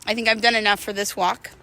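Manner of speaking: normal talking voice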